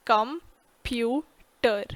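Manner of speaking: very slow, each syllable of "Computer" drawn out separately. Shown as a demonstration. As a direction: stretch "Computer" into com-pu-ter, slowly. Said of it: "Computer has three syllables".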